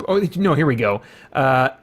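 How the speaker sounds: ordinary talk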